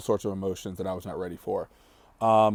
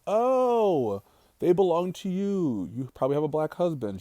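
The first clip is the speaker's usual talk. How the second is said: shocked lady; 8 semitones higher than usual. Retold or imitated. Stranger realizing the girls belong to their mother, mock shock. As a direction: imitate a taken-aback woman, pitched high then easing down. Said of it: "shocked the lady".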